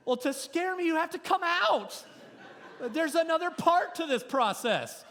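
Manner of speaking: high, loud, laughing through the point; preacher laughing as he drives the lesson home; laughing preaching. Real, not acted.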